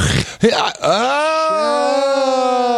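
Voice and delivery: long high falling wail; sustained high cry sliding slowly down; mock flying scream. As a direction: pretend scream, flung through the air. Pretend flight from a catapult.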